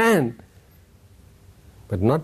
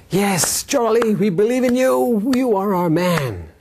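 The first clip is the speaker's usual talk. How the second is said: adoring crowd cheer; 9 semitones higher, slower than usual. Retold or imitated. Crowd cheering Charlie at the falls, high adoring cheer. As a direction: cheer him like a hero.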